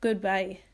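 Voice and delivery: goodbye blended quickly, English style. Shown as a demonstration.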